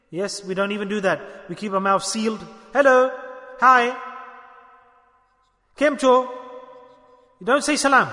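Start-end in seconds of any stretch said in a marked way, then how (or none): 2.7-6.1 louder and higher, breezy hello; hammed-up offhand hello to a friend; mock casual greeting. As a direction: toss off the greetings like a careless passer-by. Mocking casual greetings instead of salam.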